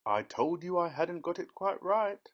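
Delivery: plain reading voice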